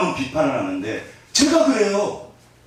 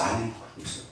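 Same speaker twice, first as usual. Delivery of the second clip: quiet contrast; 4 semitones lower, 12 dB quieter, much faster than usual. Shown as a demonstration. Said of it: "이 표정 보세요".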